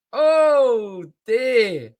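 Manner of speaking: high and loud; overwhelmed exclamation. Real, not acted. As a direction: gasp it out in disbelief. Overwhelmed fan reaction.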